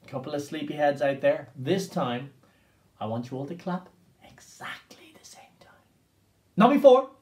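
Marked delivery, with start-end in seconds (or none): 4.3-5.7 hushed, breathy whisper to the class. Shown as a demonstration. Teacher whispers to focus kids. 6.6-7.1 sudden loud call. Shown as a demonstration. Sudden loud contrast after whisper.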